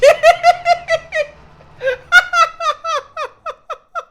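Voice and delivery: long, loud, very high-pitched laugh; piercing laugh that slowly fades; high staccato cackle. Real, not acted. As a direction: cackle in rapid, even high bursts. Caster's long laugh after GG.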